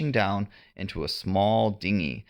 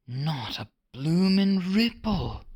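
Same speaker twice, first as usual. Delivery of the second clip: pirate whisper; 10 semitones higher than usual. A narrator's reading. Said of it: "one of the pirates whispered".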